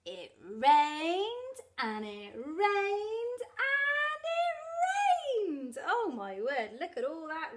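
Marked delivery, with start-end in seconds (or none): 0.0-5.8 drawn-out, rising repetition of RAINED; building storytelling emphasis. A narrator's reading. Reader tells children of endless rain, building emphasis. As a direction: stretch each RAINED, climbing higher and louder.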